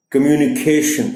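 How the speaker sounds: Communication with the beat on cation; communication, lean on केशन; केशन पर ज़ोर; stress on -ca-. Shown as a demonstration. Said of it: "stress है, वो केशन की सी पर है".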